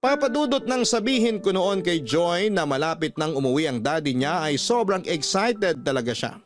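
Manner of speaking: ordinary talk, nothing marked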